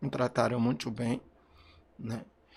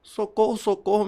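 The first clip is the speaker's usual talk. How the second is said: louder, higher retold shout for help; retold cry for help; 6 semitones higher, 6 dB louder than usual. Father retelling his cry to neighbors, loud shouted plea. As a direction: call out for help as he remembers it. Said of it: "pedindo socorro".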